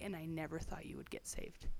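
soft unvoiced whisper; whispered quote. Cousin confiding her prayers, whispered. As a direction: hush it like a confession.

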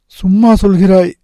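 put-on high-pitched boy's doubt; disbelieving boy. A narrator's reading. Nagarajan doubts his friend's offer, high-pitched and incredulous.

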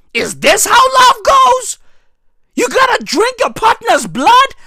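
high, loud disbelieving cry; incredulous shout. Real, not acted. Comedian shouts at the idea of love through blood. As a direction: yell it like you can't believe it.

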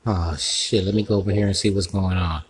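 ordinary delivery

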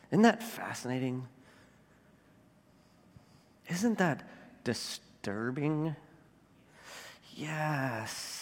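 soft and low, with long pauses; hushed, pointed questions. Real, not acted. Preacher letting grim history sink in, hushed. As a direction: drop right down and let each question hang slowly.

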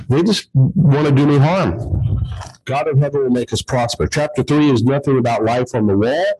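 usual speaking voice

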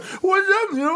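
very high, loud squeal of greeting; hammed-up falsetto hello; eager pledge voice. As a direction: squeal hello like an overexcited underdog. Dog Shit greets with mouth business.

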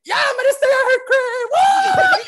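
high, loud whooping shout; ecstatic celebration. Retold or imitated. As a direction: re-enact screaming with joy to the boys. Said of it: "I was mad happy".

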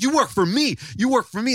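high, insistent mock outrage; imitated bossy drunk. Drunk dad pulling rank on the sound guy, mock indignant. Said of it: "He's like".

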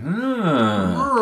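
intrigued. Retold or imitated. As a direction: imitate someone whose interest is piqued. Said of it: "he was really intrigued".

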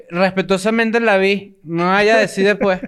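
slow, drawn-out tipsy politeness; drunk imitation. Drunk man talking to a lady, slow and careful. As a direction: drag the words like a drunk being courteous. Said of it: "esa es imitación de borracho".

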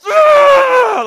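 high, loud roar of frustration; mad outburst. Retold or imitated. Furious shopper storming through the store, hammed-up mad shouting. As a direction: shout each word slowly as a stand-in curse. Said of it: "Now I'm mad".

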